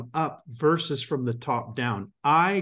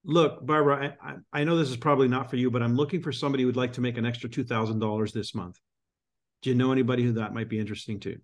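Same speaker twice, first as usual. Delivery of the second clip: quick casual ask, moving fast; fast demo pitch; much faster than usual. Shown as a demonstration. Coach demoing a quick ask to a friend, fast. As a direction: rattle the business pitch off quickly. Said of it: "and I quote".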